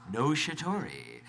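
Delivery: ordinary delivery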